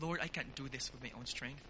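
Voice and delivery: soft, low, acted prayer; humble confession. Believer admitting weakness to the Lord, humble and hushed. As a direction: drop into a hushed admission of weakness. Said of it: "we are showing".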